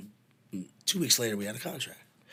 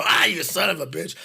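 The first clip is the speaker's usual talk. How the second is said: loud, higher mock yell; mock outrage; 7 semitones higher, 10 dB louder than usual. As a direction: curse him out, half laughing. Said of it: "and they go".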